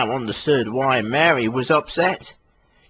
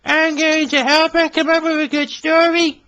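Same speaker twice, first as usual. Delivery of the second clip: Billy voice; 3 dB louder than usual. Acted. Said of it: "he explained".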